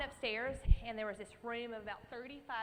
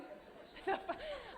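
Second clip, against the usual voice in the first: high embarrassed laugh; 14 semitones higher than usual. Real, not acted. Laughing at herself.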